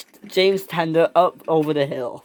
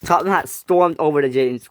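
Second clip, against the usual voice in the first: ordinary delivery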